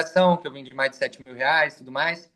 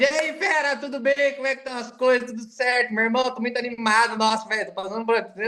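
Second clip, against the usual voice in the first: much higher, bright and upbeat; excited audio; 7 semitones higher than usual. Shown as a demonstration. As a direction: sound thrilled to hear from them. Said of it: "Agora você manda um áudio". (empolgado, animado no áudio)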